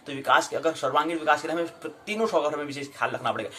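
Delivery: ordinary talk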